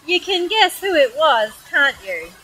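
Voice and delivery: ordinary delivery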